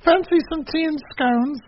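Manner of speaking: very high, slow mock British voice; terrible British accent. Wrestler offering tea in her new gimmick, terrible British accent. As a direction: put on a hammed-up, squeaky British accent. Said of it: "do a British accent, and this is terrible".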